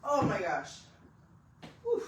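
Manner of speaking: winded relief after lifting; out of breath. Real, not acted. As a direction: puff out after the last set. Relief after finishing workout.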